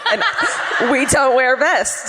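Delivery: mock Jehovah's Witness. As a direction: play the earnest doorstep believer, slowly, through a laugh.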